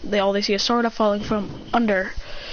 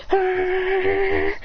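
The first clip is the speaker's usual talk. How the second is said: high clash cry; 8 semitones higher than usual. Acted. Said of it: "He goes".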